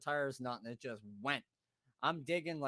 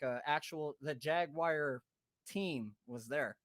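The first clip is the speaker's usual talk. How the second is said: usual speaking voice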